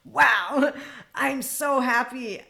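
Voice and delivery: high, loud, drawn-out mock delight; sarcastic joy. Answering those who judge her, sarcastically overjoyed. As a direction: gush with exaggerated, sarcastic happiness. Sarcasm after listing insults.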